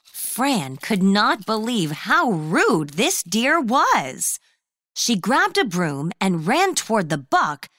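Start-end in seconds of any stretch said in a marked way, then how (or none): none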